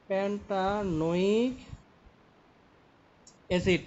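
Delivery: syllables stretched out, rising at the end; slow dictation. Shown as a demonstration. Teacher writing a compound name for a student, slowly dictating. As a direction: draw the word out as if writing it.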